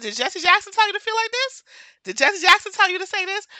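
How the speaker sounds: high, loud, mocking question; incredulous retort. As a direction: fire it back in high-pitched disbelief. Retold incredulous retort to commenter.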